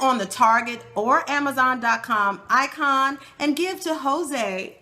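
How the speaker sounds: deliberate promotional sign-off read; announcer read. Acted. Host sending listeners to a donation website, announcer read.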